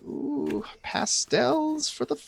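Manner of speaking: high, soft, drawn-out ooh of delight; enchanted cooing. Acted. Grimley lured by colors of fancy hats, high soft cooing. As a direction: coo slowly over something lovely. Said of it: "It's just like".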